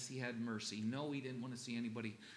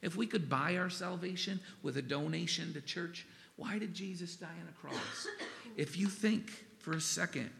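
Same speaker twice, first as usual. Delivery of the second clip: ordinary talk, nothing marked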